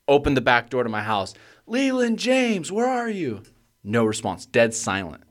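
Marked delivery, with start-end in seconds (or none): 1.7-3.4 very high, drawn-out mock scream; retold desperate scream. Parent at the back door screaming for a missing child, retold. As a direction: call the full name high and slow, not full volume. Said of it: "I screamed as loud as I've ever screamed".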